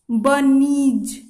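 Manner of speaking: Bunnies stretched very slowly, each sound held; slow drawn-out demo. Shown as a demonstration. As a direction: drag out bunnies syllable by syllable for students. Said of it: "इसको पढ़ेंगे इस तरीका से". (Bunnies को बहुत धीरे खींचकर बोलें)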